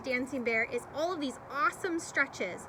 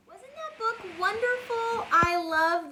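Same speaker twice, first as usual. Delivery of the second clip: delighted; 7 semitones higher, 5 dB louder, slower than usual. Real, not acted. Teacher delights after reading.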